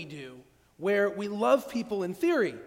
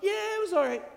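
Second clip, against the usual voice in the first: very high, louder mock shrug; mock a meh verdict; lukewarm reply; 12 semitones higher, 5 dB louder than usual. Acted lukewarm friend reply.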